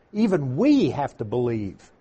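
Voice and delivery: ordinary delivery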